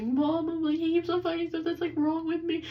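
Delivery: retold bawling. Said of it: "as I'm literally bawling my eyes".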